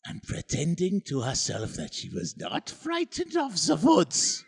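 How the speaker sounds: put-on German accent. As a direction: narrate spookily in a mock German accent. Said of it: "I'm doing a German accent".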